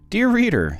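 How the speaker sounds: ordinary delivery, nothing marked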